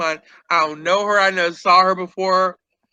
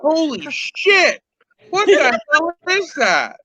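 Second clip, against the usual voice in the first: higher, slow stunned gasp; mock shock; 8 semitones higher than usual. Staring at the guest's forehead on TV, in mock shock. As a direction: gawk at the sight in mock horror. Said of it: "I'm like".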